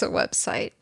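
breathy whispered aside; whispered punchline. Acted. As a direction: drop to a whisper for the reveal. Deadpan punchline after buildup.

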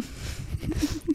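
a quiet unvoiced snicker held back; stifled laugh. Real, not acted.

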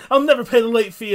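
high, loud superhero cry; hammed-up battle cry over a petty bill; Nightwing. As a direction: shout it with mock defiance. Nightwing's vow shouted again.

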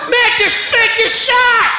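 laughing parody of a yelling sideline parent; screaming sports dad. Retold or imitated. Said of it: "screaming his stupid ass".

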